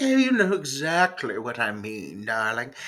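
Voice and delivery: put-on elegant British lady; mock-elegant British accent. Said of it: "She's got an elegant British accent".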